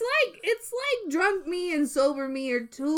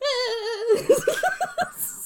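shrieking giggle fit; high cackling laugh; 14 semitones higher than usual. Real, not acted.

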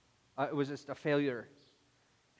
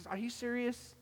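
higher, softer incredulous aside; muted disbelief; 6 semitones higher, 7 dB quieter than usual. Acted. Listener reacting privately to a pastor's story, muted disbelief. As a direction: doubt it under your breath, pitched up.

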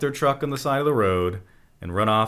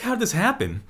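high bewildered voice; 11 semitones higher than usual. Retold or imitated. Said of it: "It's like".

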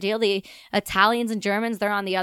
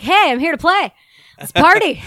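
louder and higher, a cheerful mock eager shout; eager party call; 6 semitones higher, 9 dB louder than usual. Arriving soldier knocks on the British tent, hyped and much louder. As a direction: call it out like you can't wait to start.

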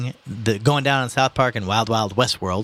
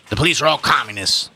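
put-on old man voice; 4 semitones higher, 4 dB louder than usual.

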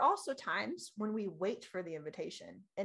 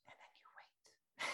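slow whisper; barely voiced, drawn-out hush; hushed waiting; 21 dB quieter than usual. Shown as a demonstration. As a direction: whisper it slowly, holding back. Demonstrates holding back and waiting.

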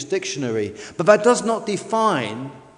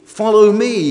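commanding call; 3 semitones higher, 9 dB louder than usual. Acted. Preacher voicing Jesus' call.

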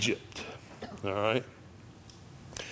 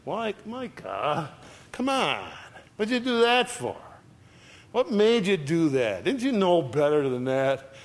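mild mock indignation, pitched up in reproach; indignant; 5 semitones higher than usual. God takes Micah to task for his sin, mildly indignant. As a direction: scold as an exasperated God, without yelling. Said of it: "sometimes God is indignant".

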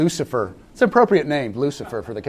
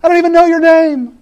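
prince calling out; 12 semitones higher, 6 dB louder than usual. Acted. Prince calling after Cinderella.

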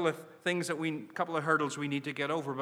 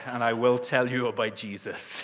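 lightly done movie-threat voice, a bit lower, laughing through it; playful mock menace, half-laughing; a hint of a grim action-hero threat; Liam Neeson accent; 3 semitones lower than usual. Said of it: "I put on my Liam Neeson accent".

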